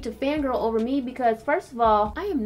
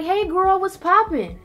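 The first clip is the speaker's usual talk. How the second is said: higher and louder, happy hello; cheerful greeting; 9 semitones higher, 7 dB louder than usual. Retold or imitated. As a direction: greet your girl with real cheer. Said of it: "I'm gonna be like".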